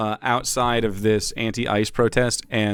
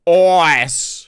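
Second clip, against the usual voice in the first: mock echo; 5 semitones higher, 8 dB louder than usual. Echoing the edited clip word.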